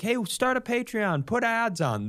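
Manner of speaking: high, bright-eyed fan voice; chirpy supportive fan. Retold or imitated. Quoting fan messages.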